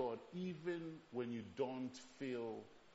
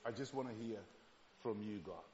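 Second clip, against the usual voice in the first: softer, lower plea; hushed, lower longing prayer; quiet pleading prayer; 5 semitones lower, 9 dB quieter than usual. Retold or imitated. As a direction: ask quietly to hear God alone. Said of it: "I'm saying".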